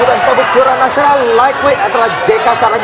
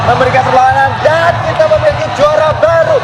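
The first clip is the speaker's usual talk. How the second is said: excited shout; 7 semitones higher than usual. Real, not acted. Crowning the new champion.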